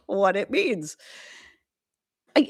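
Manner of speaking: ordinary talk, nothing marked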